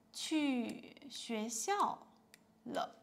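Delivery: 去学校 spoken very slowly and clearly; teacher modelling the answer slowly; slow model answer. Shown as a demonstration. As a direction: read it out slowly for learners. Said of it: "how to answer".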